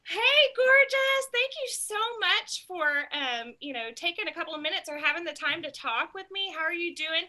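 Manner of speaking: bubbly booking-call voice to a customer; excited phone voice. Shown as a demonstration. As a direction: greet her like a friend you're thrilled to hear. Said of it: "would you show me that phone voice".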